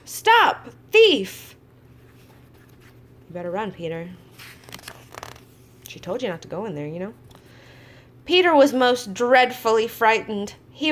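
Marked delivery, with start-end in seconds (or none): 0.0-1.5 loud angry shout, high. A narrator's reading. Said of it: "waving a rake and calling out". 3.3-7.2 quiet aside. Real, not acted. Aside to Peter, soft.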